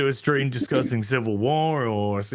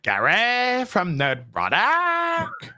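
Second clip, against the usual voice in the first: high, hugely stretched mock introduction; slow, swooping caricature of a host; Gary; 6 semitones higher, much slower than usual. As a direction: drag out every word in an exaggerated imitation. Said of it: "that's how he actually speaks".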